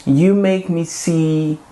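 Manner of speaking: ordinary talk, nothing marked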